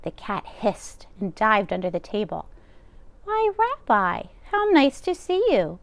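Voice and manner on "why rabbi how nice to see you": put-on a sweet old baba thrilled at the door; exclaiming old woman. Old Baba Braina greeting the rabbi, high and delighted. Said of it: "Baba Braina exclaimed".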